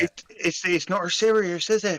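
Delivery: put-on Cornish country voice; West Country Cornish accent. Co-host backing the disguise idea, Cornish accent. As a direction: talk in a playful West Country burr. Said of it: "in the West Country Cornish accent".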